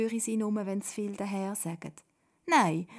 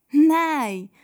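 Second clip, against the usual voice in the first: loud, high drawn-out protest; calling out; 8 semitones higher, 15 dB louder than usual. A narrator's reading. Simon protesting Toda's sentence, calling out loudly. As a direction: cry out in objection. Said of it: "ruft der Simon".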